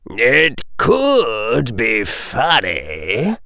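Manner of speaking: slow, drawn-out menace; soft menace. A narrator's reading. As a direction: menace in stretched-out words. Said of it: "softly and with menace".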